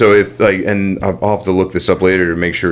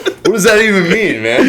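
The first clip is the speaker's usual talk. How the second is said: laughing; 11 semitones higher than usual. Real, not acted. Laughing at friend's teasing.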